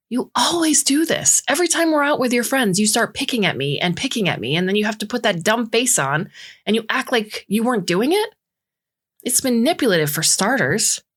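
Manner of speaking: high, heated accusation; rising accusation. A narrator's reading. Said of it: "her voice rising in volume and speed".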